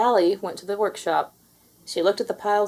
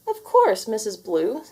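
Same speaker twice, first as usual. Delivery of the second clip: cheerful reply; 3 semitones higher, 4 dB louder than usual. Acted. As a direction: toss it off confidently. Confident princesses reply.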